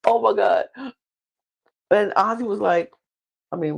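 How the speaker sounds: ordinary delivery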